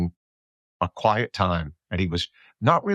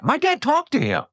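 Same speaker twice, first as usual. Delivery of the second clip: put-on much higher, boyish proud voice; imitating his sons. Future son boasting to friends that dad met him, high kid voice. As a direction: play a kid bragging about his dad, pitched way up. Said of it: "my sons are gonna go".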